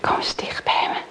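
soft breathy whisper. Acted. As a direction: whisper it close to her ear. Dying mother draws child close.

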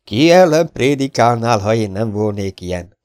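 lightly laughing mock challenge; teasing laugh. A narrator's reading. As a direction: laugh it into his friend's face. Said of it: "nevetett a szeme közé Mark".

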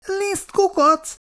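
high, slow, outraged echo; mortally offended snort. A narrator's reading. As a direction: squeal it back, deeply insulted. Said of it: "horkant fel Péteri vérig sértve". (vérig sértve felhorkanva)

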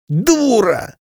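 put-on high sharp angry shout of a boy; boy flaring up. A narrator's reading. A brother snaps at his sister, high and angry. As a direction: fling the insult like a furious boy. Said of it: "взвился Жюль".

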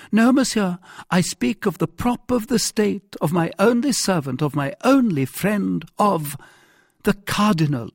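high, emphatic royal voice; insistent king. Acted. King defending the cardinal, his only friend, insistent. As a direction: correct him with mounting emphasis.

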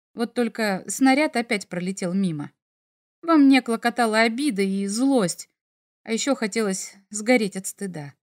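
usual speaking voice

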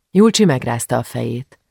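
usual speaking voice

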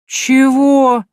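loud, high, angry what; angry outburst. A narrator's reading. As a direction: bellow it in outrage. Man bristles angrily.

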